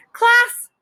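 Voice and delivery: a teacher calling the class's attention; scolding teacher. Acted. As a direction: point it out to the whole class. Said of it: "said the teacher".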